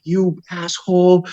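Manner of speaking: high, slow exasperated yell; frustrated cry. Retold or imitated. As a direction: cry out at your buddy in disbelief. Yelling at friend on phone.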